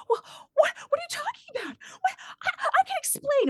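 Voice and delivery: high-pitched flustered stammer; hammed-up panicked pleading, very high; mock panic. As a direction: imitate her squealing, tearful protest. Acted flustered reply when confronted.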